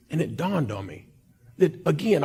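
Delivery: normal talking voice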